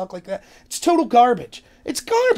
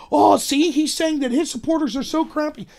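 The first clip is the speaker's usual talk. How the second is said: mock outrage; 6 semitones higher than usual. Critics taking Trump's exaggeration literally, mock outrage. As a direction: play the aghast critic, mockingly.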